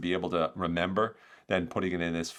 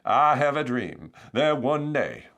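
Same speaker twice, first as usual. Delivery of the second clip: slow, raised preacher-like oratory; Martin Luther King; 6 semitones higher, 6 dB louder, slower than usual. Acted. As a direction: deliver a stirring speech, drawn out and loud. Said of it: "Forgive the, the accent".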